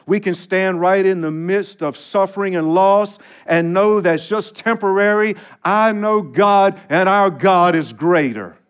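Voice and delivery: rising preacher's cadence, building; fervent sermon build; preaching. Acted. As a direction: build the list like a pulpit crescendo.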